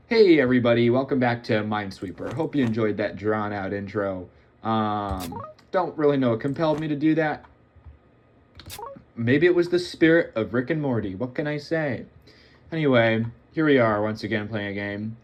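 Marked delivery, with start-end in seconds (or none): none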